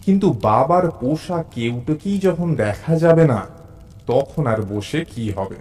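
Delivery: ordinary delivery, nothing marked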